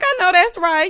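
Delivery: high, loud, through laughter; delighted laughing outburst; laughing agreement. Real, not acted. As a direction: crack up while cheering it on. Laughing as she cheers on Kathy.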